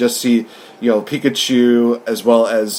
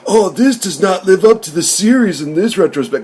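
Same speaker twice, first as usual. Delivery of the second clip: higher, whiny complaining fan; mock nitpicking fan; 7 semitones higher than usual. Parodying a nitpicking Pokemon fan, whiny and higher. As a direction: whine like a picky fan. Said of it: "I'm gonna be harping on".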